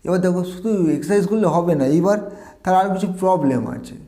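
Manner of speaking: usual speaking voice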